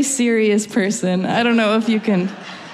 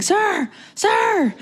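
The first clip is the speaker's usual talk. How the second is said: imitated yelling; 14 semitones higher than usual. Security calling after someone walking away, mock yelling. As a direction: call out like security hailing a stranger. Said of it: "they just start yelling at me".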